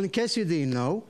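ordinary delivery

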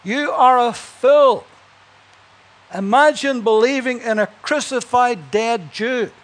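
sneering speaker. Acted. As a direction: sneer it at the crowd. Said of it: "he said, the speaker".

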